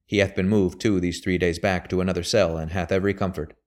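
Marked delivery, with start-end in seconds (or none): none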